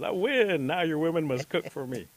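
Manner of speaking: loud, high victory crow; triumphant gloating. Acted. Rabbit claims his prize from the men, loud gloating. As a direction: crow it out like a cocky winner.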